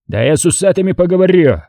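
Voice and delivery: high-pitched blustering protest; kicking up a fuss. A narrator's reading. Colonel resisting his friend's restraint, blustering. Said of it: "забрыкал подполковник".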